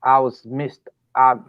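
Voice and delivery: ordinary delivery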